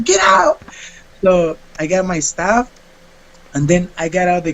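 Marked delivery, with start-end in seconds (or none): 0.0-0.6 sharp high order; mock angry shout. Teacher cutting off student's apology, high mock shout. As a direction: bark it out like the teacher.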